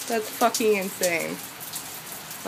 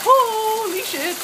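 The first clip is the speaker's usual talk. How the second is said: drawn-out, much higher holy shit; stunned awe; 11 semitones higher, 3 dB louder, slower than usual. Real, not acted. Filming the hailstorm from the porch, slow stunned awe. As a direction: let it stretch out in disbelief.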